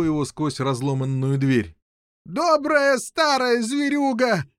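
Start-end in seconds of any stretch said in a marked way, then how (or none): 2.2-4.4 high, drawn-out cry; jubilant hollering. A narrator's reading. King cheering his beast from behind bars, high hollering. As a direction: holler high with fond delight. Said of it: "голосил из-за решётки король Пеленор".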